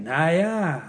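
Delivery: dismissive, offhand shrug; shrugging na ja. Retold or imitated. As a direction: brush it off with a shrug. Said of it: "Dann sag ich". (abwinkendes, gleichgültiges Na ja)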